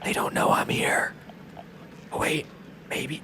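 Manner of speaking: hushed sneaking whisper. Real, not acted. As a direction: whisper so they don't hear. Sneaking past enemies.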